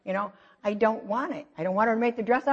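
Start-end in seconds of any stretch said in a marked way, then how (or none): none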